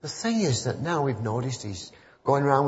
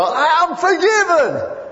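high, loud and slow, ringing out; a shy woman suddenly proclaiming she is forgiven; joyful exclamation; 7 dB louder, slower than usual. Retold or imitated. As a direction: let it ring out in triumph. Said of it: "And it just came out".

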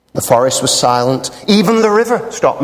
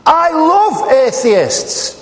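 mock sweet; 11 semitones higher, much slower than usual.

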